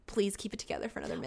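fast, whispered plea; mock whisper. Begging Zed to hold it together, quick whisper. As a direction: hiss a quick urgent aside. Urging character to stay calm.

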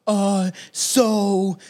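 slow mock-sincere politician drawl; lightly mock a politician faking sadness; Justin Trudeau imitation. Mocking how the prime minister talks, slow fake sadness. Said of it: "the way he talks".

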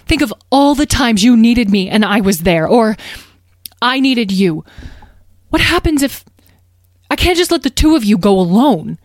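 distressed mother, high, loud, urgent protest; shocked. A narrator's reading. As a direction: refuse in alarm, faltering into broken-off phrases. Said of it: "Beatrice was shocked".

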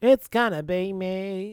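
slow, nasally boy-band singer imitation. Co-host quoting a song title, nasally pop-singer voice. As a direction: stretch it out through the nose, pop style. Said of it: "[name]'s nasally voice".

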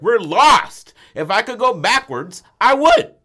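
high, loud, exasperated yelling; frustrated shout. Retold or imitated. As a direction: yell it like you're fed up and lost. Friend voiced, frustrated while lost.

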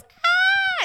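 a shrill high yelp of alarm at a sharp turn; toddler's startled shriek. Retold or imitated. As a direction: squeal like a scared toddler.

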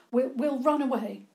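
high, urgent stammer while running; panting. A narrator's reading. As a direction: blurt it out on the run. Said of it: "George panted as they ran".

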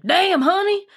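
put-on high-pitched country boy twang; country boy accent. Wife imitating her husband in the delivery room, country boy accent. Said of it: "in his little country boy accent".

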